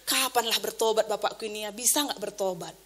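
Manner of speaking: low, hushed wondering; quiet, resigned question to oneself; inner musing. Retold or imitated. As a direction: think it aloud softly and low, doubtful. Voices private doubting thought.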